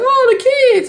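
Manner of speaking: high, loud fake sympathy; mock concern. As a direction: ham up the fake pity. Mocking critics' fake concern.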